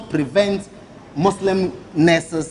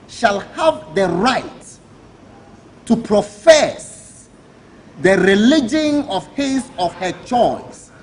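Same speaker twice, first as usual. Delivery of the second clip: slow, deliberate reading; slower than usual. Shown as a demonstration. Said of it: "I want to look straight into the cameras".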